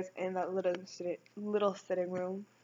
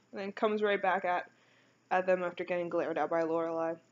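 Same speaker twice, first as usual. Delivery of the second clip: ordinary talk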